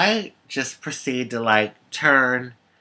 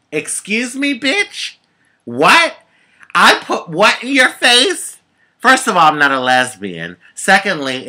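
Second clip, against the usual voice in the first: high, incredulous outrage; can't believe what she's hearing; outraged disbelief; 7 semitones higher, 4 dB louder than usual. Real, not acted. Outraged at the accusation.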